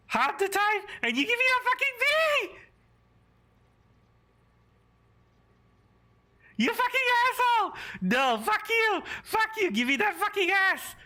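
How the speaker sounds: high, cursing fury, hammering each insult; furious shouting. Real, not acted. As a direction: scream it at the game. Raging at a low grade.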